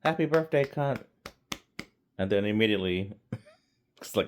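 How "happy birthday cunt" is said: cheery British guy. Retold or imitated. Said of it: "And he's like".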